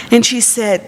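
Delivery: usual speaking voice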